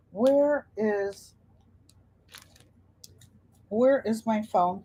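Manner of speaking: ordinary delivery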